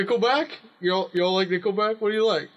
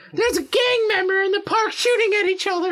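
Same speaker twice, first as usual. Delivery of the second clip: much higher, shrill-sounding granny caricature, louder; mock old lady; 4 dB louder than usual. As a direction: squeak it out like a panicked old lady. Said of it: "an old lady'd be like".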